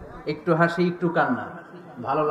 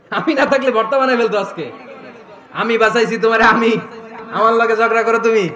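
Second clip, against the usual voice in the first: playful boastful scolding; 3 semitones higher, 7 dB louder than usual. Acted. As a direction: tease her loudly for daring to argue. Prophet teasing Aisha after rescue.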